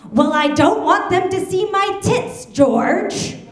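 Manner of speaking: high, loud imitation of an indignant old grandmother; shouting grandmother. Grandmother yelling at her daughter about the window, mock full-lung shout. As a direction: belt it out as grandma, top of the lungs. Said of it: "on the top of her lungs, my grandmother shouts".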